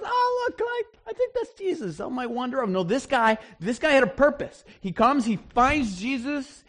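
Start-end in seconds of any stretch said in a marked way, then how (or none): 0.0-2.7 high, offhand mock-casual voice; mock idle bystander wandering by; casual passerby. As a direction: lampoon a shrug-of-the-shoulders onlooker. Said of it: "There's not some guy that goes".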